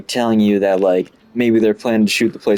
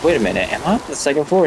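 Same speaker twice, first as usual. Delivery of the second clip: quick, higher-pitched puzzled question; retold sudden realization; 6 semitones higher, faster than usual. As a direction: act out catching your own mistake.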